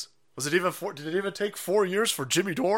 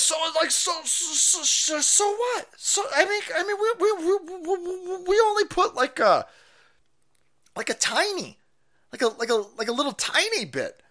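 much higher stammering falsetto; a squirming official dodging blame; mock Fauci; 14 semitones higher than usual. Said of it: "he goes".

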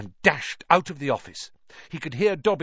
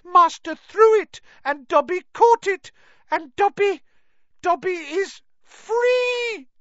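joyful elf shout; 8 dB louder than usual. Acted. Dobby realizes he is free.

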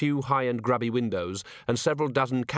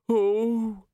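feeble dismay; 11 semitones higher than usual. A narrator's reading. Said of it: "murmured the weak of heart damply".

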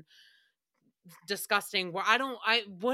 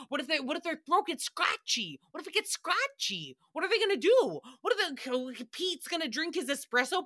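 rising panic; 6 semitones higher than usual. Retold or imitated. To the podcast hosts about the empty glasses, climbing mock panic.

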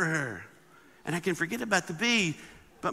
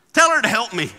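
demanding Martha; 3 semitones higher, 9 dB louder than usual. Retold or imitated. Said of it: "She says".

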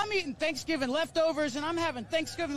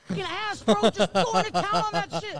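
excited; 5 semitones higher, 5 dB louder than usual. Real, not acted. Excited retelling, far higher.

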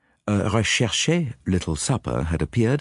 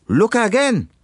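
put-on high, raised French-accented cry; triumphant command; 14 semitones higher, 5 dB louder, slower than usual. Detective orders the watchers to look, commanding. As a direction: call it out as a dramatic reveal.

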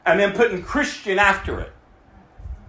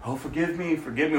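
tossed off low and much softer; flippant; 6 semitones lower, 9 dB quieter, faster than usual. Retold or imitated. Preacher mocking cheap repentance, flippant mumble. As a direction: mumble it carelessly, like it means nothing. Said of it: "We're not flippant about it".

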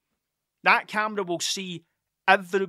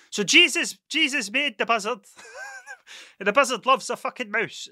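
mock RE teacher; 4 semitones higher than usual. Mocking the RE lesson for the camera, higher pious teacher voice. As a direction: ham up a preachy RE teacher. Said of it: "talk to you in R E".

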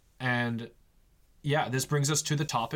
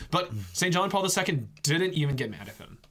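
ordinary talk, nothing marked

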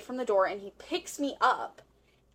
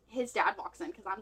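ordinary delivery

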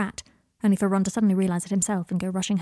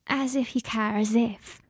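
slightly slurring; slower than usual. A narrator's reading. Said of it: "slurring her words slightly".

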